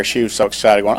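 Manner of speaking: ordinary talk, nothing marked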